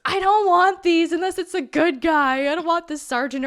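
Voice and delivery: hammed-up childish protest, fading slightly; mock tantrum. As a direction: refuse like an indignant kid. Acts out kid rejecting toys.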